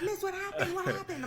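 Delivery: hammed-up fake concern; mock concerned boys. Boys voiced in retold scam.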